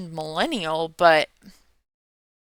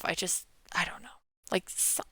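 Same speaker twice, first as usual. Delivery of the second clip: whispering; 15 dB quieter than usual. Real, not acted. Trailing off unsure about songs.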